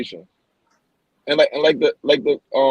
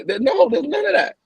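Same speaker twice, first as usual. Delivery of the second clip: heated rant; 7 semitones higher than usual. Real, not acted. Heated rant about poverty.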